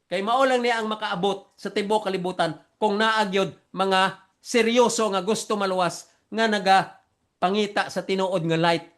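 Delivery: ordinary delivery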